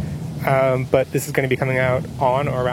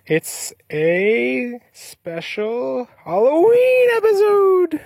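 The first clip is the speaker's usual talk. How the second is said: spooky announcer; 9 semitones higher, much slower than usual. Acted. Announcing spooky Halloween episode.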